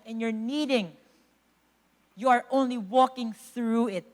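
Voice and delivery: ordinary delivery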